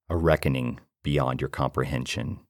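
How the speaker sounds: plain reading voice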